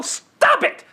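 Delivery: fed-up viewer shouting at the movie; exasperated shout. Retold or imitated. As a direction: yell it at the screen. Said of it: "and go".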